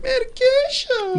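a high, slow, mock-crying wail. Teasing a friend on medication, high mock wail. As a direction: wail the word like a hurt child.

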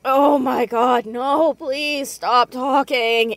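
mortified outburst. Acted. Mortified niece cuts off uncle.

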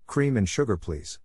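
ordinary talk, nothing marked

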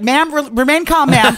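high, slowed, put-on dispatcher voice; mocking dispatcher. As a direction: mimic the operator calming her down. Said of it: "And they're like".

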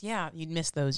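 usual speaking voice

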